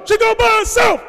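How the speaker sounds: very high shouted retort; imitated yelling. Father-in-law insisting she go alone, imitated yelling. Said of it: "he yells out".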